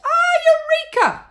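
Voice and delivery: high, loud shout of discovery; eureka moment. A narrator's reading. Mister Cranky finding the missing ingredient, triumphant shout.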